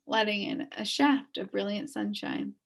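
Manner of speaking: plain reading voice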